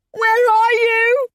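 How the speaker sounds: slow, very high anguished howl. Acted. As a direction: howl it in despair. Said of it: "I howled".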